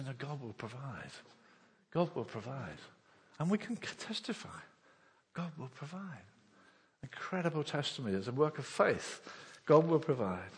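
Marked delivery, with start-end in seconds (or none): 0.0-6.2 quiet. Acted. Soft repeated faith declaration.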